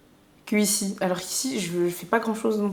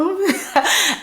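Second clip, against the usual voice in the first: high-pitched burst of laughter; 10 semitones higher, 4 dB louder than usual. Real, not acted. Laughing at her own English bragging, amused.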